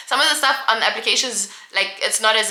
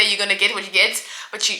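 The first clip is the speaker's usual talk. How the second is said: ordinary delivery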